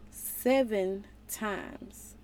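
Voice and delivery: seven times stretched very slowly, stress on seven; slow emphatic correction; slow, drawn out. Acted. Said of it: "Not seven times,' Jesus replied".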